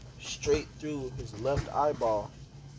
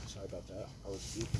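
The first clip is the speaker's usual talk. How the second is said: much softer, offhand apology; soft sorry off to the side; quiet aside; 5 semitones lower, 13 dB quieter, much faster than usual. Real, not acted. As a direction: drop your voice for a quick aside. Apology aside mid story.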